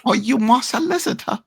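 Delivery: high teasing echo of solicitor; mock high voice. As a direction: pitch way up, parroting the fancy word. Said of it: "I'm just saying it how they say it".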